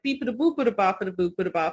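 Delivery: rapid-fire fake Italian babble; very fast mock-Italian patter; mock Italian gibberish. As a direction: rattle off nonsense as pretend Italian. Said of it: "I know how to speak Italian".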